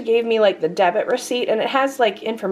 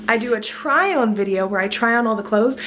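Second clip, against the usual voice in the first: ordinary talk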